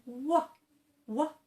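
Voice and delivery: "Wa" with rounded lips; Indian-learner W for V; W sound. Shown as a demonstration. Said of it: "pronounce it with a W sound".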